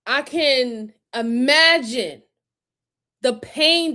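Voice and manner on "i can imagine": ordinary delivery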